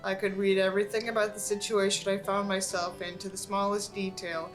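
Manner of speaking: ordinary delivery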